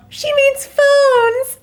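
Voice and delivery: gushing. Real, not acted. Gushing over the dog's phrase.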